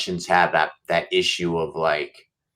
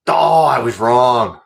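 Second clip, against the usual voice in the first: higher, louder and slowed, caught off guard; surprised realization; 6 semitones higher, 10 dB louder, slower than usual. Real, not acted. Commentator admitting his pick was wrong, surprised and loud. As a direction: blurt it out as the fight flips on you.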